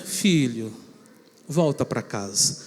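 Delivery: low, soft, slow plea; tender mother. Retold or imitated. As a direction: gently call the boy home. Mother comforting son.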